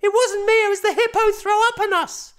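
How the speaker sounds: put-on high, loud little boy protesting; child voice. A narrator's reading. Boy protesting innocence to dad, high loud child voice. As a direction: insist like a small boy caught out.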